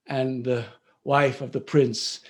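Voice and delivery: ordinary delivery, nothing marked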